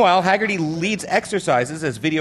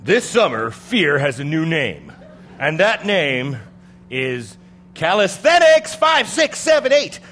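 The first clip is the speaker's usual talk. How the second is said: horror trailer voice; 4 dB louder than usual. Acted. Spooky intro to Harobics story.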